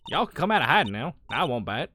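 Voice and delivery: playful Southern gal; Southern US accent. Acted. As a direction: tease in a country Southern accent. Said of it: "so I could do this accent".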